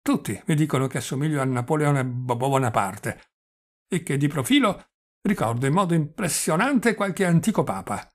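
put-on stammering, chatty old prince; halting old man, catching on his consonants; doddering old prince. Old prince reminiscing to his nephew, doddering and stammering.

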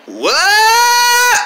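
long rising high wail; drawn-out high-pitched cry; exasperated yell. Acted. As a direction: let it climb into a disbelieving shriek. Acted exasperation at friend.

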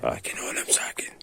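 hushed, gentle whisper; whispering to calm the growling dog; soothing whisper. Real, not acted. Hushing a tense dog.

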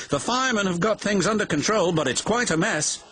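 quick, high-pitched report; calling out. A narrator's reading. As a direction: call the news over briskly. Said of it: "he called".

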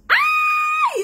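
very high, loud squeal of delight; a thrilled, piercing ay; excited shriek. Real, not acted. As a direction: scream with joy at the palette. Delighted by the palette.

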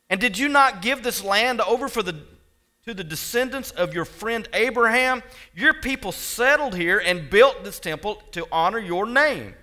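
loud, impassioned scripture reading; fervent preaching. Acted. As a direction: declaim it to God from the pulpit. Preaching Jehoshaphat's prayer.

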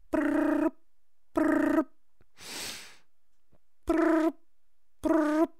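high, steady trilled ringing; even, high-pitched brr of a phone; imitated phone ring.

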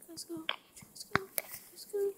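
whispered let's go; hushed eager urging; whispering. Real, not acted. Urging in game.

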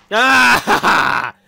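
long loud, high "aah!"; startled scream. Real, not acted. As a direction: scream out at a scare. Jump scare.

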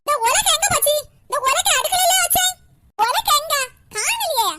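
loud, high mock-furious yelling at the daughter-in-law; stage a furious hunt for the pestle; fake angry scolding. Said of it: "எல்லாம் நடிப்பு". (பொய்யான கோபத்துடன் உரத்த கத்தல்)

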